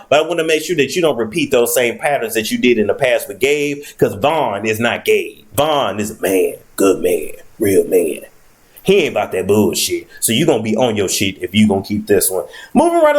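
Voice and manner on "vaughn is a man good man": ordinary talk, nothing marked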